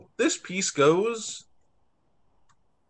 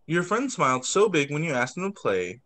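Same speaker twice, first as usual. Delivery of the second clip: ordinary delivery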